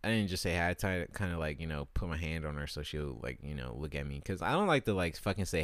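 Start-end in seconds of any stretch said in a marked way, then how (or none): none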